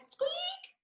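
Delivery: very high whispered squeaks; high, whispered mouse squeak; tiny squeak. Acted.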